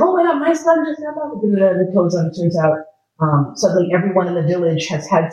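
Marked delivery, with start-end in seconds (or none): 0.0-1.3 high, breathless joy at a son's return; excited mother. A narrator's reading. A villager tells her neighbor her son is back, overjoyed. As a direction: gasp it out, overjoyed and much higher. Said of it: "and she's like".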